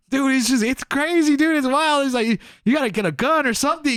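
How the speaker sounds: high, urgent imitation of a friend; excited warning. Friend in truck warns of chaos, excited.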